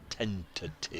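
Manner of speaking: TENTATIVE spaced out slowly, syllable by syllable; slow careful demo. Shown as a demonstration. Commentator showing a word he struggles to say, slow careful "Tentative". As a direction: say "Tentative" slowly so as not to trip. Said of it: "unless I say it as slowly as that".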